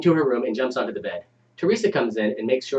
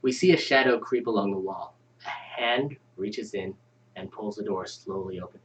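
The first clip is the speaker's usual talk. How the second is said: ominous story narration; 4 semitones lower, 5 dB quieter, slower than usual. Acted. Reads horror scene setting.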